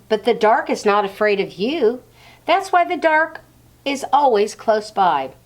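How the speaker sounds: the dark's speech. Acted. The dark explains to Laszlo why it matters, earnest. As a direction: make the dark sound grand and persuasive. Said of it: "creaky as the roof ... smooth and cold".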